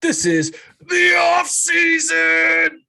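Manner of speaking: full-throated show-opener yell; big hype shout. Acted. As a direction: belt it out like an arena announcer. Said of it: "blowing an aneurysm every time you do that".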